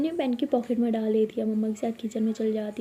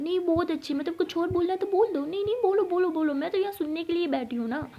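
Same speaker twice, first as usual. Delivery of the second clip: sarcastic taunt; 6 semitones higher, 4 dB quieter than usual. Acted. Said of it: "Abira, enough".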